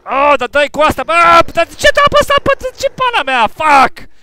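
very high, loud angry shouting; furious yelling. Real, not acted. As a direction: rage at teammates. Teammates kill him.

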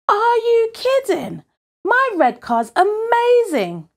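high-pitched, incredulous protest; indignant boast. Acted. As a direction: defend it in outraged disbelief. Boy indignantly defends his car.

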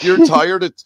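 mock Karen voice; mocking Ladybug. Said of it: "She's like".

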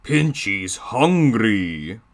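very slow, drawn-out villain voice; Diesel 10. Acted. YouTuber previewing his villain train impression, drawling slowly. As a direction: stretch each word out with sinister relish. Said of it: "where I'm sounding all".

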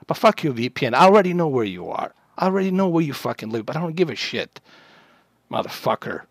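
ordinary delivery

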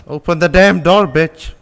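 real frustrated shout; angry yell. Real, not acted. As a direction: yell it at a door that won't open. Yelling at a stuck door.

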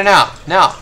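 usual speaking voice

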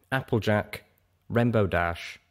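ordinary delivery, nothing marked

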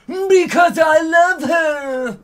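high, loud, drawn-out shriek. A narrator's reading. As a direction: shriek the confession slowly. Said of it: "Draco shrieked".

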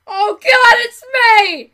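loud, high alarmed cry; mock shock.

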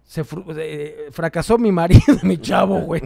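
imitación de borracha, lenta y arrastrada, que sube de volumen; mamá borracha. Retold or imitated. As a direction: remeda a su mamá bien peda lamentándose. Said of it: "Mi mamá se puso un pinche pedón loco". (la mamá peda se queja del fracaso del marido, imitada arrastrando y fuerte)

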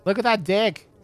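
excited gawking. Real, not acted. Gawking at corpse in game.

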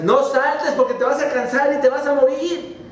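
high, shouted warning, dragging at the end; frogs shouting harder. Acted. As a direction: yell it down the well. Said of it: "le gritaron los familiares".

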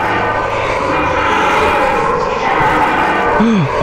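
long unvoiced gasp; frightened gasp. Real, not acted. Streamer dreading the next creature, long gasp. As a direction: hold breath in dread.

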